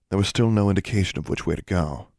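ordinary delivery

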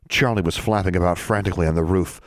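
ordinary delivery

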